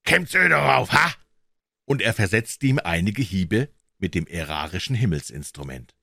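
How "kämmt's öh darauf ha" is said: high, raised Bavarian challenge; irate Munich porter demanding an answer; angry yell. A narrator's reading. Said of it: "schrie er".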